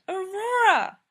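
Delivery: high, loud pleading call; whiny plea. Acted. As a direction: beg her loudly, high.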